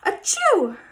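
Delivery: another sharp sneeze. Acted. Gerald keeps sneezing.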